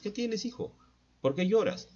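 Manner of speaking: put-on high-pitched parent asking a crying child; concerned parent. Parent asking crying son why he cries, high-pitched. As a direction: voice a parent asking what's wrong.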